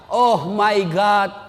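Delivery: drawn-out, high mock prayer; child praying. Child praying at a parent's grave, hammed-up pleading. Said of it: "anak berdoa".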